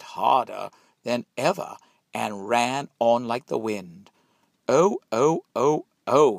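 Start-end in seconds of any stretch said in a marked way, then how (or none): 4.7-6.4 high, loud oh-oh; gleeful cry. A narrator's reading. Gingerbread boy cries out with glee as he runs. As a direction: crow with glee. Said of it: "he cried".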